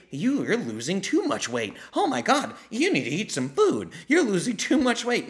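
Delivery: high-pitched fussing imitation; hammed-up horrified fretting; mock alarm. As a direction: mimic a panicky worrier, voice leaping up. Mimics alarmed people fussing over weight loss.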